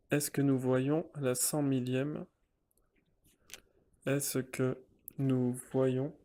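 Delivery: ordinary delivery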